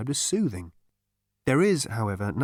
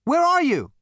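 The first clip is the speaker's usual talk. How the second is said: high loud shout; calling out; 8 dB louder than usual. Acted. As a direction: yell after a fading voice. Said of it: "called out Zaphod".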